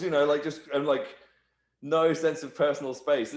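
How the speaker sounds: usual speaking voice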